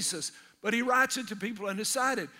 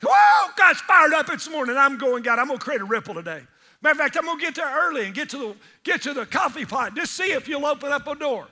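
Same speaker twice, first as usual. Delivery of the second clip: high, loud, excited shout; fired-up morning; 3 semitones higher, 7 dB louder than usual. Retold or imitated. Preacher acts an eager believer, loud excited shout. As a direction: wake up ready for mission.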